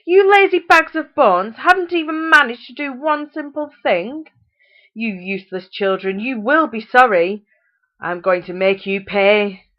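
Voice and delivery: high, loud furious rant; furious mother. A narrator's reading. Mother threatening her useless children, furious and loud. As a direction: tear into them, threatening.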